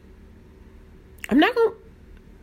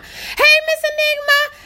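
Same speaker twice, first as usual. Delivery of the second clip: very high squealed hello; excited high-pitched greeting. Real, not acted. Streamer spotting a viewer, excited high-pitched hello. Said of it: "my voice get high-pitched".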